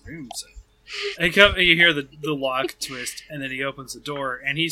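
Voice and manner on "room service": breathy whispered repeat; a GM whispering a player's line back. Acted. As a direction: murmur it under the breath.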